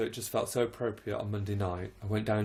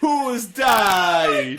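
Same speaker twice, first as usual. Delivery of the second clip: high loud mock caller shout; hammed-up high shouted caller voice; caller's put-on voice; 9 semitones higher, 14 dB louder than usual. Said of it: "this is the voice that she did on the phone".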